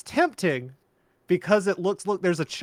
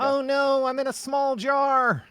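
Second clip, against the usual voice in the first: high, thin, nasal Lynch-like voice; David Lynch voice; 10 semitones higher, slower than usual. Pretend. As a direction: squeak it out like a tiny trapped man. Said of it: "more David Lynch voice".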